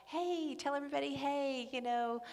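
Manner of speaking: lightly voiced apostle, high and cheery then pointed; cheerful greeting turning to a pointed question; mock Paul. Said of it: "every letter of Paul is like".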